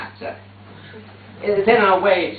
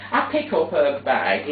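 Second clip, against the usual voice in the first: normal talking voice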